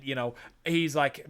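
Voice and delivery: ordinary delivery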